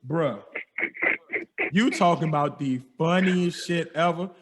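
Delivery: ordinary delivery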